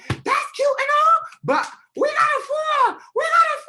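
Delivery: very high, loud yell; re-enacted shout. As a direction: shout over a squabbling room. Retells breaking up the quarrel shouting.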